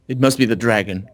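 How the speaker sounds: usual speaking voice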